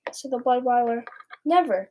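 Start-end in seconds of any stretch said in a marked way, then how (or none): 1.4-1.9 higher, louder outburst of denial; sudden impassioned exclamation; passionate cry. A narrator's reading. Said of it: "cried the B F G passionately".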